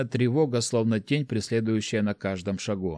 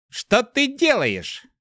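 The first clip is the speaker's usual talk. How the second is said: high, drawn-out alarmed cry; urgent, agitated voice pitched way up; 13 semitones higher, 3 dB louder, much slower than usual. A narrator's reading. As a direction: cry out in agitation, stretching the words. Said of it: "услышал взволнованный голос".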